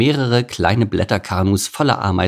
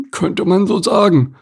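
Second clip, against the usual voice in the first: high-pitched grudging grumble; grumpy opossum; 11 semitones higher, 5 dB louder than usual. Acted. Opossum admits he needs help, grumpy. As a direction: grumble it in a put-on animal voice. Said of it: "brummte Otto".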